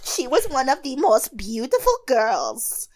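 cracking voice. Retold or imitated. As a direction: voice cracking like Natasha. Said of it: "all the cracks that she has in her voice".

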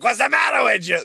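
high, loud Mickey-style trainer bark; gruff trainer shout. Retold or imitated. Comedian voicing the Rocky trainer shouting at his boxer. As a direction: yell at the fighter from the corner.

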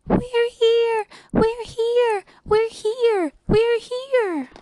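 tiny high voices crying out; very high, thin-voiced chant of little people; fearful cry. A narrator's reading. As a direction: squeal to be heard from a speck. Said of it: "they cried out in fear".

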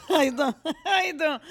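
high, loud mock New York accent; New York greeting. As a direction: laugh through a big New York hello.

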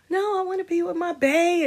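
high-pitched mock-lovesick refusal; imitating oneself, high and softened; mock lovesick protest. Mimics herself refusing friends' advice.

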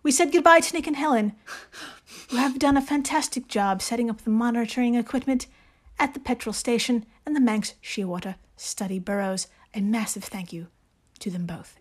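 hammed-up British accent, measured reading; amateur British accent with deliberate pauses; Jane Austen voice. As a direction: read it like a genteel British lady novelist. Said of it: "in your best Jane Austen voice".

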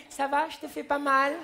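high mock female voice; lightly voiced girlfriend checking in; imitating a woman asking. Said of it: "vous nous demandez".

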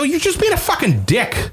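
indignant, voice shooting up high; high, exasperated scolding; angry retelling. Retold or imitated. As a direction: re-enact telling off a heckler. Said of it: "because my voice goes up".

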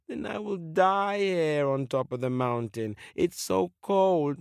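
plaintive, drawn-out fish voice; despairing fish. Acted. Fish left to die on the mountain, plaintive moan. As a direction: moan piteously about the cold.

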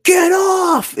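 very high, loud mock scream; hammed-up screech at another kid; retold angry yell. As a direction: imitate a furious girl yelling. Girl yells at kid on box.

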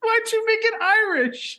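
squeaky laughing question; can barely ask for laughing; laughing high. Real, not acted. Laughing at accent.

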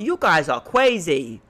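put-on slow little-kid lisp, crazy said as "cwazy"; little girl mispronouncing. Little girl reacting to yelling grown-ups, imitated childish mispronunciation. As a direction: imitate a small child who can't say her R's. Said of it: "to pronounce words correctly".

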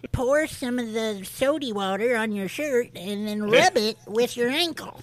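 high-pitched put-on old lady; old stain-removing lady. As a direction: give folksy cleaning advice as a comic granny. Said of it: "One old lady that's removing everyone's blueberry stains".

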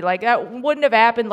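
normal talking voice

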